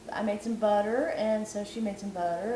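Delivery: normal talking voice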